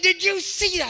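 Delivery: high, loud, outraged woman; retold outrage. Furious director bursts into the pastor's office, mock outrage. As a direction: imitate a woman too mad to talk straight. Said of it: "came in my office so mad".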